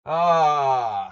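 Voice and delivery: long loud disgusted "ah"; loud groan. Real, not acted. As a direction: let out a loud fed-up groan. Reacting to the Caro-Kann.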